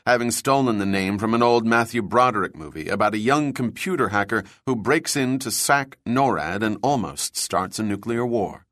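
ordinary delivery

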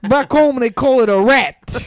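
Italian boasting about home; mock Italian. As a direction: bellow it like a proud Italian. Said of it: "They're like".

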